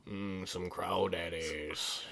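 put-on whispered Cajun accent, slow; slow whispered Cajun crawdaddies; weird accent whisper. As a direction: breathe it in a mock Cajun accent. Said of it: "that was a weird accent".

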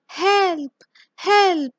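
storybook scream, short of full panic; narrated scream for help. A narrator's reading. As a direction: call out for help, mildly hammed-up. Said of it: "runs for her life and screams".

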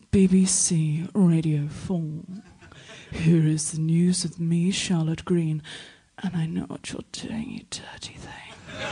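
slow, low, hushed radio newsreader; Charlotte Green. Retold or imitated. Radio four newsreader introducing the news, seductively hushed. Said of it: "that thing she does where she's like".

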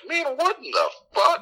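high-pitched mock bafflement, half laughing; bewildered reaction. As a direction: squeak out your disbelief. Retells bewildered reaction on rewatching.

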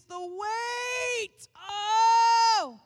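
high, loud crying out; anguished cry. Acted. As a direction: cry out under the weight. Mock crying out.

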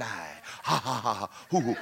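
low, soft chuckling; real laughter. Real, not acted. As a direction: laugh quietly to yourself. Laughs at his own joke.

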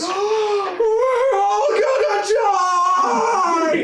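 high, drawn-out terrified scream; panicked screaming. Acted. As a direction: wail it like you're about to die.

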